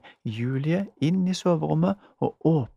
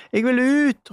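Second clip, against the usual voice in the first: higher, louder frantic cry; shouting again; 11 semitones higher, 8 dB louder than usual. A narrator's reading. Julie shouting again to be let out, louder. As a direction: yell it out even higher. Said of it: "ropte Julie igjen".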